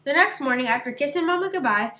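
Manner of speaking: usual speaking voice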